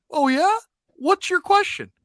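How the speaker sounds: high, loud mock interest; mock-interested host. Radio host baiting a caller. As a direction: act curious, baiting him. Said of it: "the caller goes".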